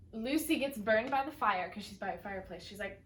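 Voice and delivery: ordinary delivery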